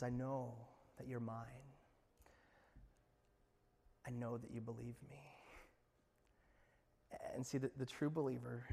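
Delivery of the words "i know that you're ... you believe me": soft, intimate; tender Jesus. Acted. Jesus assuring the believer he is his, softly. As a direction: say it gently and low.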